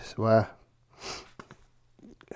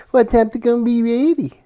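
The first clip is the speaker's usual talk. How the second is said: high, sassy, hip-twisting daughter voice; sassy daughter impression; 8 semitones higher than usual. Father mimicking his daughter on the phone, sassy and high. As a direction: put some sass and swing into it. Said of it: "She says it like that, twist her hips".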